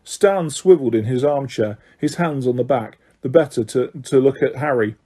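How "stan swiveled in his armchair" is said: ordinary delivery, nothing marked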